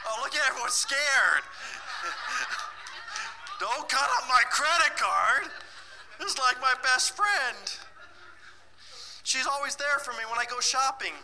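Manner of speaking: high, laughing mock-whine about her card; mock scared participant. Teacher mocking a workshop participant with a high laughing whine. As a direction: play the clingy shopper pleading to save her card.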